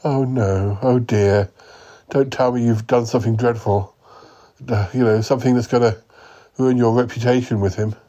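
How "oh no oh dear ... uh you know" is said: usual speaking voice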